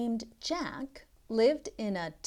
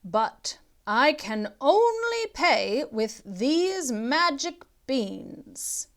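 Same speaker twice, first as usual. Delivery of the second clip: put-on old man voice; 5 semitones higher, 6 dB louder than usual. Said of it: "said an old man".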